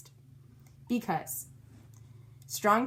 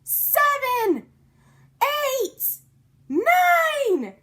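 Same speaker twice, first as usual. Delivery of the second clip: higher and louder each count; building shouts; 8 dB louder, much slower than usual. A narrator's reading. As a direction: count up to a scream. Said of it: "we shouted".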